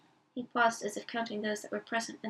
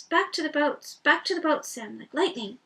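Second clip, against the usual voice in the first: urgent realization; 4 semitones higher, 7 dB louder than usual. Acted. Sam urging himself to run.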